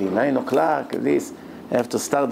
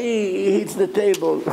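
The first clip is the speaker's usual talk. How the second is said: normal talking voice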